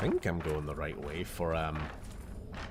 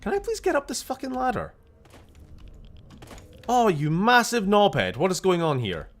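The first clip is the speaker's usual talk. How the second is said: high, loud frustrated outburst; real high-pitched gamer frustration; exasperated; 13 semitones higher, 7 dB louder than usual. Real, not acted. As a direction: yell at the game in exasperation. Exasperated at stuck ladder.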